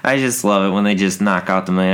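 usual speaking voice

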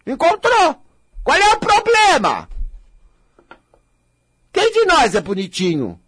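loud, high-pitched comeback; defiant challenge. Acted. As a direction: throw it back at them, voice way up. Voices justified retaliation, defiant challenge.

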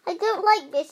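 high, loud complaint. A narrator's reading. Rosie being laughed at by the children, protesting loudly.

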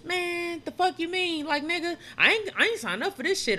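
high whiny griping voice; whiny complaining. Retold or imitated. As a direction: gripe like you got cheated. Hebrews complain in the desert.

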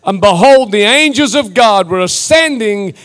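declamatory Bible reading with stretched key words; scripture reading. Real, not acted. Preacher reads Genesis from the King James, declamatory delivery. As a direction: proclaim the passage in measured, emphatic phrases.

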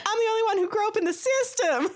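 hammed-up comedian's high cry, laughing through it; high-pitched punchline. Fan retelling a foster care joke, high and laughing. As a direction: throw the punchline out high, amused.

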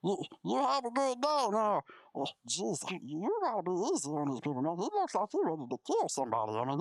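ordinary talk, nothing marked

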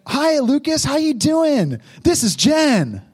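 high, chirpy friend voice, pitched well up; playing the matchmaking friend up high; imitating Melissa. Voicing Melissa introducing friend.

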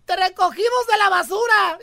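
hammed-up furious mom shout; angry mom. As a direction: yell it like a fed-up mom scolding her kid. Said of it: "le van a decir".

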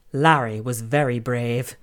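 plain reading voice

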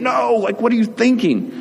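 strained, high mock yell; exasperated mock scream. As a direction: cry out in disbelief, voice shooting up. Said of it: "This is where you're just screaming".

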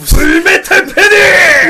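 high, drawn-out bellow; over-the-top title shout; theatrical battle cry. Acted. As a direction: roar the title slowly at full voice. Acted bellow of anime title.

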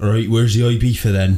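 put-on northern English accent. Buyer asking after the Ibiza, northern accent. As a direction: imitate a northern bloke casually asking. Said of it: "I don't know why it's northern".

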